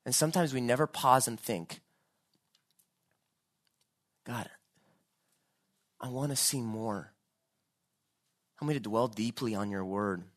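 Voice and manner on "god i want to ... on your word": soft, low plea to God; hushed prayer. Acted. Preacher praying to see God more, hushed. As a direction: pray it quietly, with pauses.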